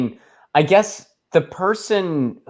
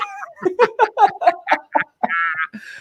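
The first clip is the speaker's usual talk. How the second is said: laughing at himself. Real, not acted. Hears own accent on podcast.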